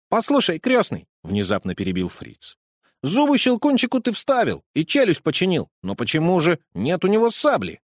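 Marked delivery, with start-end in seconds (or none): 0.1-1.1 put-on a lively boy poking fun, pitched up; cheeky boy. Boy Fritz interrupts. 3.0-7.8 put-on a lively boy poking fun, pitched up; cheeky boy. Boy Fritz asks.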